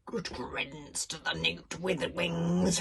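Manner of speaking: slow, sneering aside; bully sneering to his friend; sly sneer. A narrator's reading. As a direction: sneer it slyly. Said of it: "whispering, sneering, slyly".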